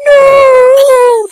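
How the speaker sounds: high, loud, long-stretched mock cry; slow-motion no. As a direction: wail it like a slow-motion movie moment. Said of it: "The slow-mo, no".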